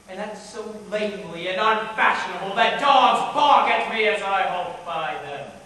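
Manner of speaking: loud, aggrieved declaiming; theatrical railing at your own lameness; bitter outburst. Acted. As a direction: swell with bitterness, then fall at the end. Bitter soliloquy on own deformity.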